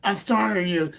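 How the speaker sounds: high, loud mock boast; hammed-up strongman taunt; mocking a tough guy. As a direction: sneer it like a chest-thumping bully. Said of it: "Mister Mayhem banging on my garage door".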